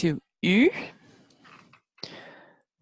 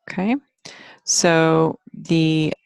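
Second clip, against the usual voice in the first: usual speaking voice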